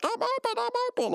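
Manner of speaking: very high squeaky mock call; Rigby. Retelling a laundry football game, very high mock calling out. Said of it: "Ruby all like".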